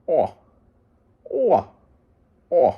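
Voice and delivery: high moose bellows; moose challenge call. Retold or imitated. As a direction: call out like a bull challenging another. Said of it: "they usually say".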